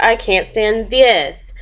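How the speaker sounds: slow fed-up line ending on a long "this"; stress on THIS. A narrator's reading. Mister Bear in the noisy garden, stressing "this". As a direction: land heavily on "this". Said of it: "it's all capital letters".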